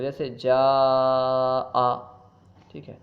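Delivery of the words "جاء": "جاء" with a very long, level aa; stretched vowel (madd). Shown as a demonstration. Teacher gives an example of madd, "جاء" greatly stretched. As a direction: draw out the vowel of "جاء" slowly. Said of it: "کھینچنے کو".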